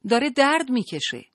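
loud high shout across the room; woman shouting to the nurse from a distance, pleading; shouting from afar. A narrator's reading. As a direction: call out across the crowd, desperate. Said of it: "از همان فاصله داد زد".

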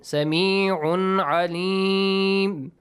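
Sami'un alim chanted very slowly, the n sounded clearly; clear idhar tanween. Shown as a demonstration. Teacher demonstrating normal tanween, Sami'un alim clear and drawn out. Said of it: "Normally re-, pronounce the letter, the tanween".

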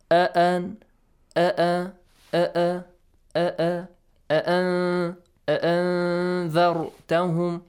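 أأ as two separate glottal catches, on a chanting tone; double hamza drill. Shown as a demonstration. Teacher drilling a difficult word, أأنذرتهم with two distinct hamzas. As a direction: repeat أأ crisply, then hum into أأنذرتهم. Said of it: "two hamza sounds are there".

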